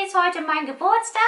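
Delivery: eager quick guessing; excited puppet. Pretend.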